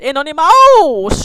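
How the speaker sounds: এনোনিমাউস with the final "maus" stretched high and loud; exaggerated drill pronunciation. Shown as a demonstration. Teacher drilling a spelling word for the class, এনোনিমাউস drawn out and loud. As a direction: swoop up loud and long on the end of এনোনিমাউস.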